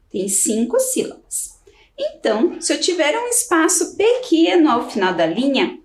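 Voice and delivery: ordinary delivery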